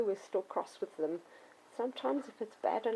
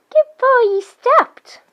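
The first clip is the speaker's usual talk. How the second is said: much higher, much louder praise; high excited praise; 19 dB louder, much slower than usual. Shown as a demonstration. Trainer praising the puppy, high and loud. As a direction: gush over the dog, high and loud. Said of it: "and the praising".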